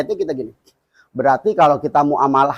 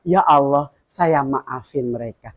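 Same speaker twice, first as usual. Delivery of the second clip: softly spoken prayer; 4 semitones lower, 5 dB quieter, slower than usual. Shown as a demonstration. Teaches a model prayer.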